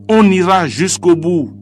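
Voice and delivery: high, loud declaimed cry; rallying cry. A narrator's reading. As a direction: cry it out as a vow to the crowd. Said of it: "L'homme s'est écrié".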